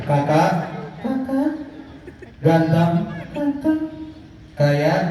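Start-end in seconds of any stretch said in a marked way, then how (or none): 1.0-1.5 high, soft puppet voice repeating Kakak; monkey puppet voice. Acted. Coba bilang. 3.3-3.8 high puppet voice repeating Ganteng; monkey puppet voice. Acted. Coba bilang.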